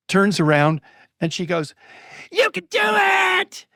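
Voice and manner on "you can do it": very high, drawn-out shouted cheer; high strained mock yell; child's yelled encouragement. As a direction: imitate a little girl hollering to a player, stretching each word. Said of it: "she yells at him".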